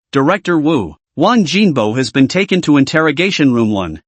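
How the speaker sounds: ordinary delivery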